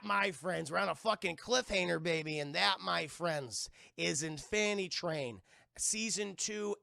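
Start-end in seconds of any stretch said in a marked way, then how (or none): none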